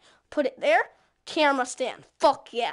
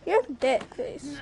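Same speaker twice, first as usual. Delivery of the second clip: usual speaking voice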